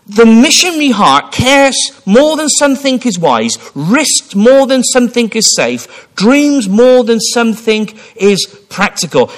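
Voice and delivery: high, loud, slow proclamation; full-force preaching. Acted. Preacher proclaiming the saying to the congregation, high and loud. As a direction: proclaim each line slowly and loudly.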